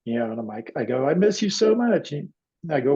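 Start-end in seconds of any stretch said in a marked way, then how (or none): 1.1-2.1 high-pitched, yearning confession; reliving an emotional reunion; heartfelt longing. Retold or imitated. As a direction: tell a lost parent you miss them. Son hugging dead father in dream.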